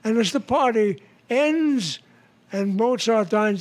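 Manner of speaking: ordinary talk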